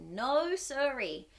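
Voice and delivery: plain reading voice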